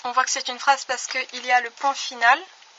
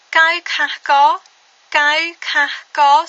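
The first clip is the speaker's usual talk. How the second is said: Kai kah ko said very slowly and loud; slow model reading; 6 dB louder, much slower than usual. Shown as a demonstration. Teacher to learners, slowly modelling the sentence.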